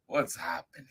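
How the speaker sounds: ordinary delivery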